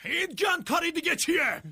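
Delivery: high-pitched outraged shout; angry scolding. Acted. Scolding Oswald over the mess, angry shout. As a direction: demand it at the top of your voice.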